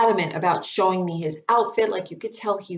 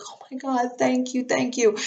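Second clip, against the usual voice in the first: higher, breathless gratitude; elated thanks; 6 semitones higher than usual. Retold or imitated. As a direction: gush with relief and joy. Elated after the confirmation.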